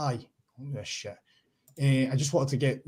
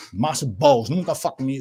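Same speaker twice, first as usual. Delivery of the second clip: ordinary talk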